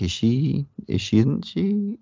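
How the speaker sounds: rising, teasing suspense questions; imitating a tense will-she-won't-she; mock suspense. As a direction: tease the doubt with climbing pitch. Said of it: "Veronica Cartwright just, like".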